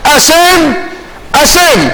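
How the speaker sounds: lightly imitated child's plea; child-like call. Shown as a demonstration. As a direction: call on God as a child calls a parent. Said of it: "תקראו לי כמו שילד קורא לאבא שלו".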